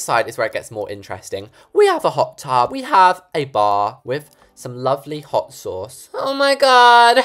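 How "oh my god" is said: high, loud, drawn-out shriek; long squeal of shock; shrieked exclamation. Real, not acted. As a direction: cry out at the top of the voice. Playful shriek during the tour.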